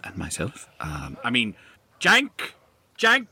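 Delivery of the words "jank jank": high, loud, slow bossy yell; bossy high shout. Acted. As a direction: order the servant around. Character shouting at servant.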